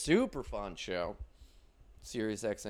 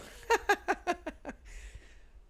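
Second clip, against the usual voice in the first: high airy laugh. Real, not acted. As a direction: let out a high wheezy laugh. Laughs at own joke.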